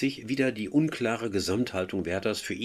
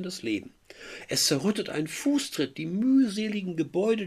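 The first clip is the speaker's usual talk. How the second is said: dramatic lament; 9 semitones higher than usual. Acted. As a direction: mourn each crushed creature. Teacher reads Werther's despair.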